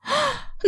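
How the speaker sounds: high, sudden intake of breath; sharp gasp. Acted. Mira opening the door to Ahmed, shocked gasp.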